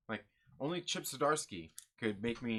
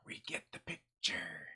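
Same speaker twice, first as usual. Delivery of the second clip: exasperated whisper; 6 semitones lower, 5 dB quieter, slower than usual. Retold or imitated. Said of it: "was kinda like".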